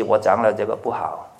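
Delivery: usual speaking voice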